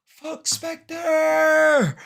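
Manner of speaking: high, drawn-out yell; outraged shout. Real, not acted. Reacting to the shot for Spectre.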